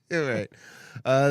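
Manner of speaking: ordinary talk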